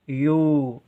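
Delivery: यू with the oo held long; sustained यू, as a spelling demo; long held vowel. Shown as a demonstration. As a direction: stretch the vowel in यू. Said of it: "देर तक हो रहा है".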